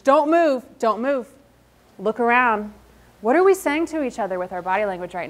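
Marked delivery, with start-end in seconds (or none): 0.0-0.6 command. Shown as a demonstration.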